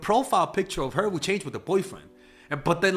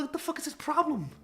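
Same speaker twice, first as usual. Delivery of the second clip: incredulous; 12 semitones higher, 5 dB quieter than usual. Retold or imitated. Incredulous after landlord leaves.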